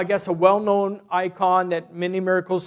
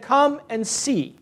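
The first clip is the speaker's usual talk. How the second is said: slow, drawn-out come and see, a little higher; bishop's invitation; 5 semitones higher, slower than usual. Retold or imitated. Bishop welcoming a stranger at the monastery, slow and inviting. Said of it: "He just said".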